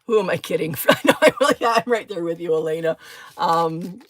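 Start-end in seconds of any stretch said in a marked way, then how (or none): none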